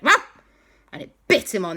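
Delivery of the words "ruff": short, high imitated yap; little dog bark. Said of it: "the little doggy went".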